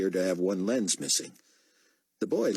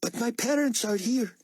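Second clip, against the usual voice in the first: put-on high, worried boy voice; young Greek boy. A narrator's reading. Boy telling Buck his parents are inside, worried. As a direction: worry about his parents. Boy's voice.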